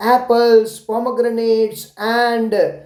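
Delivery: slow, careful model answer; spelled-out teacher answer. Shown as a demonstration. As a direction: say it slowly so the child can copy. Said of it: "ऐसे आप बोलिए".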